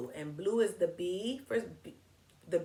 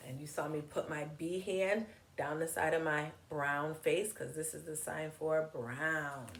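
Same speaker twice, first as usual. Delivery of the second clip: ordinary delivery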